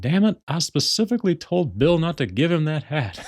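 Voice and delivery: irritated high-pitched cartoon dad; mock-annoyed sitcom father impression; Hank Hill. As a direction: grumble in a put-on exasperated dad voice. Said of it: "Hank just gets so irritated".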